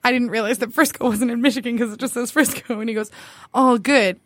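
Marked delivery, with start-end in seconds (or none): none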